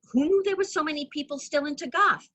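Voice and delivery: high-pitched, incredulous outburst; amazed exclamation. Acted. Friend telling Jude about the costumed crowd, amazed. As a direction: let the amazement push your voice way up.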